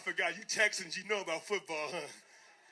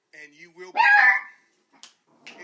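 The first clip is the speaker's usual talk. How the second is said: huge slow shout, way louder; booming warning; 10 semitones higher, 21 dB louder, much slower than usual. Acted. Coach to players, a slow booming shouted warning. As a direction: drag it out like a threat from a stage.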